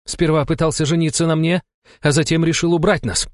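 high, tense woman's voice, climbing; seething accusation. A narrator's reading. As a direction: press each charge with contempt. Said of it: "процедила Алла". (процеживая обвинения)

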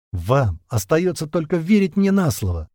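ordinary delivery

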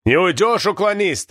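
high-pitched restrained roar; roaring threat. A narrator's reading. Border guard chasing a draft dodger, roaring. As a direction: bellow after a fugitive, pitch well up. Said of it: "ревел за спиной пограничник".

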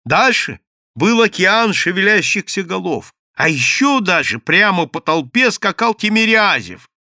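usual speaking voice

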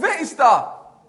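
high, loud, drawn-out echo; echoed shout. Acted. Forest voice comes back at hunter, echoed shout. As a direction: send the shout back, slightly softened. Die Stimme kommt zurück.

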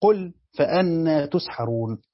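solemn Quranic cantillation; Quran recitation. Shown as a demonstration. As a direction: recite it slowly, letting the verse ring. Teacher recites Quran verse.

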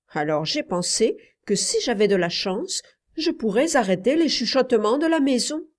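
put-on high-pitched child's voice, insistent; earnest boy Paul, confiding. Boy telling his uncle it is for mother, earnest.

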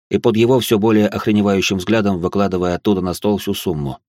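ordinary delivery

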